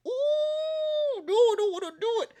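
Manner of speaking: high, fast panicked squeal; squealing reluctance. Retold or imitated. About to turn on cold water, high squealing protest. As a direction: squeak it out like the cold already hit.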